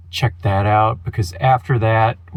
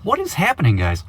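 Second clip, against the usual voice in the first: excited greeting; 7 semitones higher than usual. Real, not acted. Vlog greeting to viewers.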